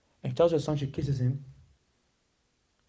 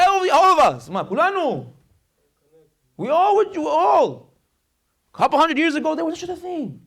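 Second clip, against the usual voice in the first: high, emphatic cry to the crowd; impassioned preaching; 10 semitones higher, 5 dB louder than usual. Acted. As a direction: call out each phrase with raised pitch. Preaches at full force.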